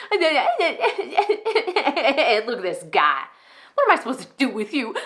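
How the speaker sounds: derisive outburst, pitch jumping; Enid, narcissistic pastor. Acted. Enid belittles her executive pastor, derisive. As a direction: laugh in scorn at an underling. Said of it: "how insane they can sound at times".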